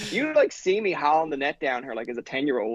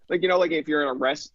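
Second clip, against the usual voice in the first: ordinary delivery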